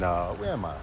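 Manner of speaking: ordinary talk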